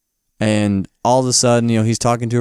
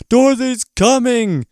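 much higher, slow panicked cry; shrill alarm; 13 semitones higher, 4 dB louder, slower than usual. Retold or imitated. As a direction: cry out the warning. Mombi voiced in retelling.

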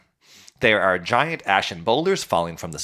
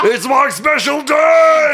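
high gleeful yell; excited shout; 10 dB louder, much slower than usual. Pretend. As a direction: bellow it with glee. Voicing Vulcan celebrating.

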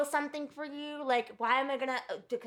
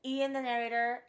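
ordinary talk, nothing marked

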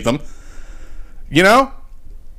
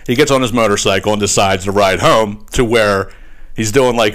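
ordinary talk, nothing marked

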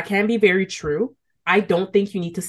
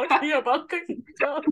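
high-pitched laughing speech; 9 semitones higher than usual. Real, not acted. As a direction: talk through high, helpless laughter. Hosts cracking up at their joke.

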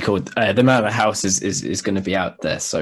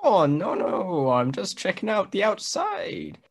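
higher, slowing, put-on innocent voice; disguise voice; 9 semitones higher, slower than usual. Guest dodging the nosy man in a disguise voice. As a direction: play it innocent in a funny high voice. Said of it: "I thought we were doing disguise voices".

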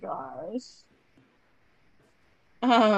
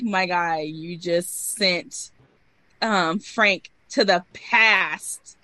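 exasperated retort; 4 dB louder, much slower than usual. Retold or imitated. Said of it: "And I'm like".

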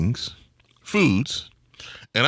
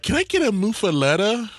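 high-pitched mock tourist asking; out-of-towner; 12 semitones higher than usual. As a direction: imitate a clueless visitor in a higher voice. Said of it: "when people from out of town be like".

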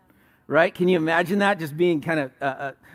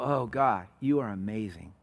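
hushed worship; 6 semitones lower, 5 dB quieter than usual. Pretend. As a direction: lower and softer, in awe. Worship response to God.

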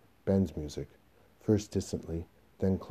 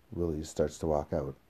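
normal talking voice

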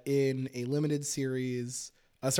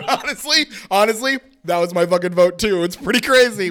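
laughing excited agreement; 4 semitones higher, 8 dB louder than usual. Real, not acted. Laughing agreement about voting him off.

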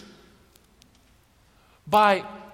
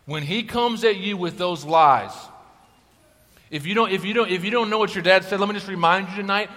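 normal talking voice

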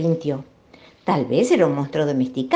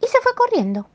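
scampering off. A narrator's reading. Monster dashes away.

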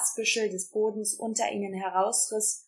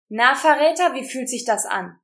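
taunting; 4 semitones higher, 7 dB louder than usual. A narrator's reading. Kaho taunts the traitor.

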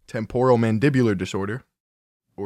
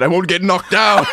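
quoting him; 7 semitones higher, 7 dB louder than usual. Retold or imitated. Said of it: "He said".

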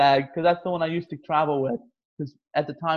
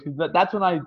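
ordinary delivery

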